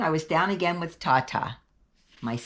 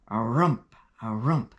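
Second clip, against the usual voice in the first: creaky ghost thump; 4 semitones lower, 3 dB louder, slower than usual. Acted. Ghost's clunky creaky sounds.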